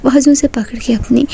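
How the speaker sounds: plain reading voice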